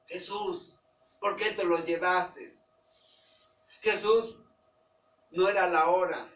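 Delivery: loud, high, crying out to God in protest; anguished reproach. Pretend. Grieving believer loudly reproaching Jesus over lost relatives, anguished shout. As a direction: demand an answer from heaven, near shouting. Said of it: "Y empiezo yo a reclamarle".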